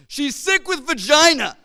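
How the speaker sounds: loud, high, slow shout. Retold or imitated. Nine-year-old shouting an excuse to the kayaking friends, full yell. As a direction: yell it across the water at them. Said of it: "I shout at them".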